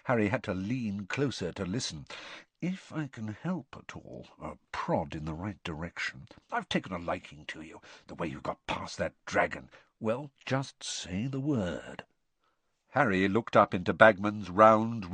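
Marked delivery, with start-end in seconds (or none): 2.6-12.0 hushed, confidential offer; very quiet. A narrator's reading. Bagman secretly offers Harry help, very quietly. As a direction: murmur it so he must lean in. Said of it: "his voice was so quiet now".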